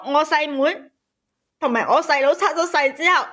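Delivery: usual speaking voice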